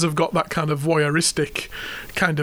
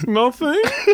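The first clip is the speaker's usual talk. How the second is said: put-on grinning child; 10 semitones higher than usual. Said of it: "flashes you a massive grin and goes".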